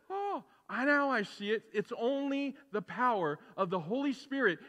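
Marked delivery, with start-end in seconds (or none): 0.1-1.6 high-pitched gasp of dawning insight; mock sudden realization. Imagined listener won over by clever words, acting suddenly enlightened. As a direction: jump up high on the "Oh" as if it just clicked. Acts out someone suddenly getting it.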